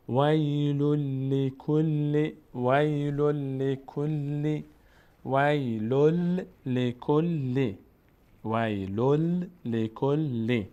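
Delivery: slow chanted ویل لکل with each l held and doubled; doubled lam. Shown as a demonstration. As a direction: recite ویل لکل steadily, drawing out the merged l. (تلاوت کشیدهٔ «ویل لکل» با لام مشدد)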